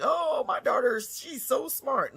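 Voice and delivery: high, slow bragging parent voice; sing-song bragging mother, mocked; mock proud parent. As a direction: ham up a smug proud mother. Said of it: "we walk around here like".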